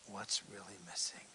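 hushed, unvoiced aside; sermon aside whispered to the crowd. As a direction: lean in and whisper it. Drops to a whisper.

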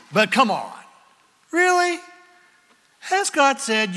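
high, incredulous mock disbelief; mocking serpent. Serpent mocking God's word to Eve, high scoffing.

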